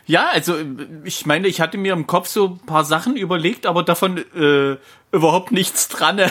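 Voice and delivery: usual speaking voice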